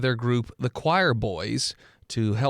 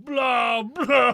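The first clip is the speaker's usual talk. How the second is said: gurgling fish man croak; 10 semitones higher, 4 dB louder than usual. Acted. Imitating fish creature.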